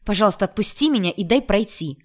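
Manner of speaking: high, loud indignant outburst; angry. A narrator's reading. Angry woman demanding to be let past, indignant and loud. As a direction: snap at him in anger, pitch jumping up. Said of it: "она почему-то рассердилась".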